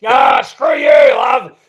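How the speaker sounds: loud, high mock outburst; big playful roar of defiance; defiant shout. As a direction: yell it out like a hammed-up rebel. Acting out defiance at therapist.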